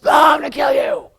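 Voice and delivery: high, loud masked-prankster scream; mock killer shout. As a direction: leap out and yell to scare. Roommate in mask jumps out.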